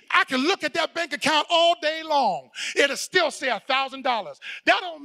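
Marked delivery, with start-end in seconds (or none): none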